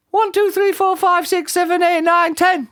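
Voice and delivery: very high squeaky counting; anxious counting. A narrator's reading. Mister Jelly trying the tramp's secret, anxious high count. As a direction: count through the fright in a squeaky voice. Frightened character counts to calm down.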